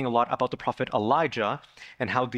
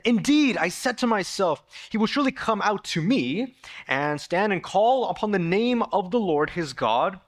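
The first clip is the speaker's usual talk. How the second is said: indignant, raised and loud; furious; 7 semitones higher, 4 dB louder than usual. Acted. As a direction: rant like a proud commander insulted. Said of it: "Naaman became furious".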